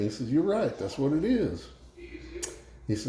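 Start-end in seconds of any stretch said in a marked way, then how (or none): 0.3-1.7 high-pitched, lightly Cajun concession; Cajun accent. Acted. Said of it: "you guys wanna hear a Cajun joke".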